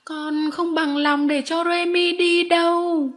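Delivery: put-on high, slow, loud childish wail; wailing refusal. A narrator's reading. Arthur refusing his mother over Remy leaving, loud drawn-out wail. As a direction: drag it out as a stubborn child's protest.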